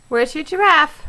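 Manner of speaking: normal talking voice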